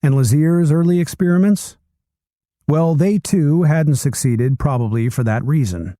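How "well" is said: ordinary delivery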